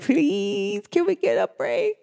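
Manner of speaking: mock plea for a break; pleading. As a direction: plead in a light, high voice. Acting out her weary people begging.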